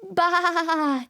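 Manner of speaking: long, quavering baa; sheep bleat. Pretend. Teacher answering what a sheep says for small children, quavering sheep bleat. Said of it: "What does a sheep say".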